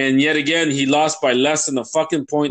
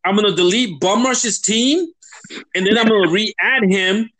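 fast, higher-pitched fed-up tirade; real grievance, pitched up and hurried; exasperated rant; 4 semitones higher than usual. Real, not acted. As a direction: rant it out in a worked-up rush. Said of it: "Sorry for my rant".

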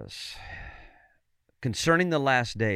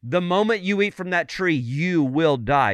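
slow, stern warning in a preacher's quote; imitating a solemn divine command; emphatic command; 5 semitones higher, 6 dB louder than usual. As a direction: lay down God's rule, higher and deliberate. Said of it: "He had emphatically said it".

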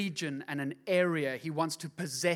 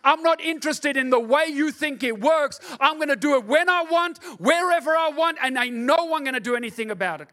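higher, louder, defiant declaration; God's reply; 9 semitones higher, 8 dB louder than usual. Acted. God answering the church's deliverance meetings, loud and high. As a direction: speak as God, unstoppable and firm. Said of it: "God is looking in and going".

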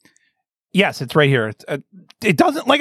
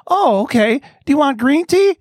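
high, mock-sweet offer; cooing mock hospitality; gushing host; 7 semitones higher than usual. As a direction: imitate an eager host, pitched up. Mocking the tea host again.

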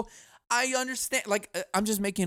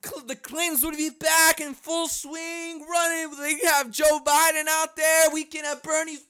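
high, hammed-up telethon host voice; shouted mock fundraiser cheer; mock celebrity hype; 10 semitones higher than usual. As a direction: whoop it up like a fake charity event host.

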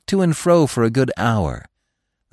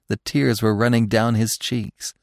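plain reading voice

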